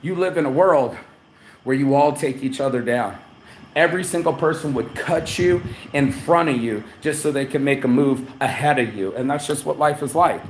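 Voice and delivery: ordinary talk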